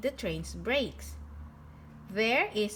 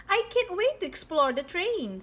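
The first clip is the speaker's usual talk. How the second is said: eager; 8 semitones higher, 4 dB louder than usual. A narrator's reading. Pete eager to explore train.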